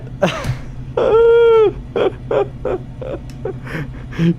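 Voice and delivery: long, high squealing laugh; helpless laughing fit. Real, not acted. Streamer remembers the relay joke, high laughing fit.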